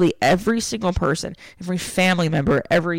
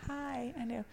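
soft dog talk; 7 semitones higher, 16 dB quieter than usual. Real, not acted. Soothing the dog.

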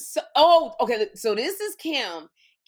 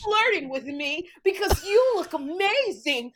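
Kim; 4 semitones higher, 3 dB louder than usual. Retold or imitated. Friend showing how Kim flirted on stage, mocking impression. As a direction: gush in a put-on high voice, mocking your friend. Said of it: "I'm gonna tell you how Kim said it".

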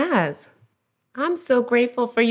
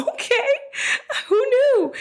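laughing. Real, not acted. Mother amused by her kids' answers, laughing.

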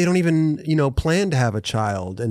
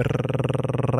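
long trilled brrr showing off; rolled r; 4 dB quieter than usual. Shown as a demonstration. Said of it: "I got the rolls".

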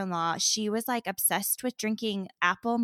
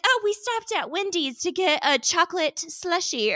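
breezy excuse; 9 semitones higher, 4 dB louder than usual. Retold or imitated. Brandy inventing a cover story.

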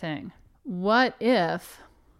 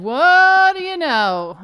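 long, loud, high-swooping crow; mock amazement; 9 semitones higher, 10 dB louder, slower than usual. Real, not acted. Host vindicated about the sister theory, crowing in mock amazement. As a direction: stretch it out slowly in gloating surprise. Mock triumph at being proved right.

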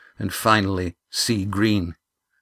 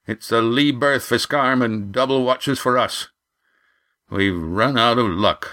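lightly voiced skipper, settling to grim news; skipper giving orders; 3 semitones higher than usual. A narrator's reading. Roaring Jack directs the crew after the collapse, lightly commanding. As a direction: hand out brisk orders to the crew.

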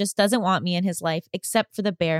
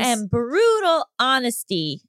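bright announcer intro; show intro; 5 semitones higher than usual. Acted. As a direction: present the show, upbeat.

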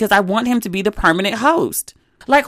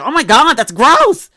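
louder and higher, recoiling in disgust; 6 semitones higher, 5 dB louder, slower than usual. Retold or imitated. Daughter, mistaken for her father's wife, reacts in disgust. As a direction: spit it out like something tastes rotten. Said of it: "I was, like, absolutely disgusted".

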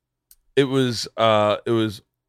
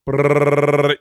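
gunfire noise; 3 semitones higher, 5 dB louder than usual. Acted. Imitating gunfire.

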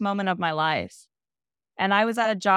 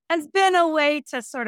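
laughing through tears, voice jumping up; a small embarrassed laugh while choked up; teary laugh; 9 semitones higher than usual. Real, not acted. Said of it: "sorry, I'm getting teary".

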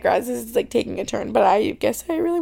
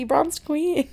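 "Bronzed queen" said a bit higher, with playful sass; sassy self-compliment; 5 semitones higher than usual. Retold or imitated. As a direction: hype yourself up like a diva. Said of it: "and I'm like".

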